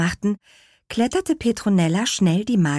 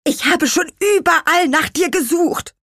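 very high, raised little character voice; relieved call; 13 semitones higher, 5 dB louder than usual. A narrator's reading. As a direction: blurt it out high and loud with relief. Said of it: "rief er erleichtert".

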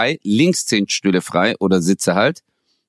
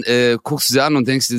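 ordinary delivery

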